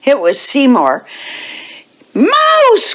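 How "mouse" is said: very high, startled cry of alarm; high-pitched cry. A narrator's reading. Cats spotting Seymour at lunch, high startled cry. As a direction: cry out in a far higher voice. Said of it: "cried the other cats".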